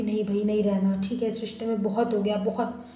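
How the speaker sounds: soft, subdued, mildly sad resignation; dejected giving up. Acted. Disappointed lover walking away from the relationship, quietly dejected. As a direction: let it sink quietly, a touch dejected. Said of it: "आप ना जा रहे हो इनसे उदास हो के".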